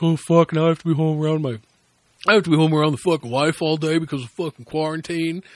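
a lightly voiced dumb complaining husband, fast at first; mocking stupid husband. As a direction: sneer at the guy stuck home with his wife. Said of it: "you see things where it's like".

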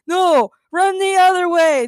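high, loud, drawn-out yell; screamed warning. A narrator's reading. Fleeing couple warning the heroes off, screaming. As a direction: scream it slowly across the distance. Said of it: "Daniel and Daniela screamed".

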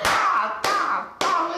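retelling the slaps, mouth-made slap noises; imitated slaps. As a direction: mimic three quick slaps to the face. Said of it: "Foi assim, ó".

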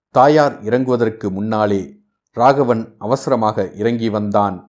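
usual speaking voice

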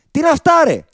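exasperated. Real, not acted. Exasperated rant about free throws.

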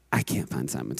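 very low, soft repeat of bad news; subdued confession. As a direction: admit it quietly, voice dropped.

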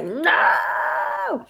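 high wailing "No!"; anguished cry. Retold or imitated. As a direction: wail it out in despair. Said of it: "I was like".

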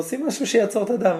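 high-pitched, agitated retold order; urgent plea, pitched well up; agitated demand. Wounded king asking to stop the bleeding, agitated.

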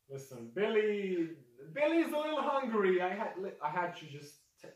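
high, loud, pleading excuse; flustered beast owner. Acted. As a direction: make hurried excuses for your misbehaving pet. Orc figure makes excuses for Billy.

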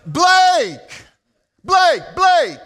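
high, loud calling out for a lost son; frantic yell. Retold or imitated. Father calling for missing son, frantic shout. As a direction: shout the name across the dark. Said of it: "now I start yelling".

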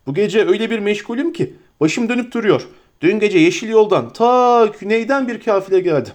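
a flustered, fussing landlord; bustling innkeeper. Acted. Innkeeper Butterbur welcoming the hobbits, eager and fussy.

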